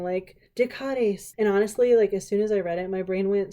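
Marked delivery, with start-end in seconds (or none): 0.6-1.3 slow, drawn-out "decades" as dih-KAH-dees; accented-E pronunciation. Shown as a demonstration. Admitting how she misreads it, "decades" as dih-KAH-dees. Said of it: "So now I'm over here saying".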